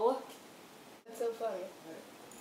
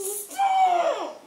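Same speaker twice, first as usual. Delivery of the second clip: shriek; 7 dB louder than usual. Real, not acted. Loud shriek.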